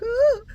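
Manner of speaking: high anxious little ooh; nervous ooh. Retold or imitated. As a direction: squeak out a worried ooh. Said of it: "but inside I'm like".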